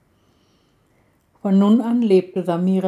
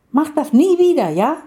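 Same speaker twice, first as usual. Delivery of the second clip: much higher, louder stern warning; scolding outburst; 8 semitones higher, 5 dB louder than usual. A narrator's reading. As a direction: warn him sharply, loud and high. She scolds him after danger. (laute, hohe Ermahnung)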